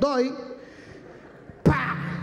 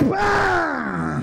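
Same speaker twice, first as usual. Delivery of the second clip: sharp, high shouted smack; shouted slap noise; 6 semitones higher than usual. Retold or imitated. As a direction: bark out the sound of the slap. Slap sound imitated.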